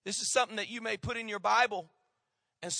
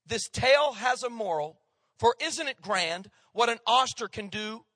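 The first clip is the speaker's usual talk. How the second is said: raised, measured verse reading; poem recitation; 4 semitones higher, 5 dB louder than usual. Acted. Preacher recites a poet's oyster verse to the congregation, declaimed. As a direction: declaim the verse line by line with strong beats.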